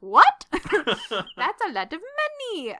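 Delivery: loud, high "What?" then slow laughing disbelief; overwhelmed by the money; shocked delight. Retold or imitated. Peter shocked by payment.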